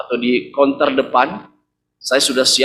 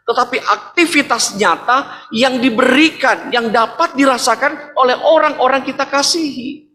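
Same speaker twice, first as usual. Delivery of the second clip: ordinary talk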